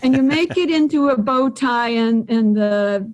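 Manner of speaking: ordinary delivery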